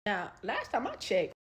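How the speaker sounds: normal talking voice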